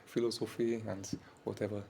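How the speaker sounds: ordinary delivery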